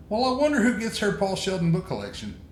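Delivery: a hint of a curious little girl; lightly voiced daughter. Quoting daughter's odd question.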